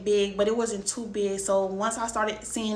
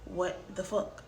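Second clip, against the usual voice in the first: muttered under breath; 9 dB quieter, slower than usual. Retold or imitated. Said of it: "I'm like".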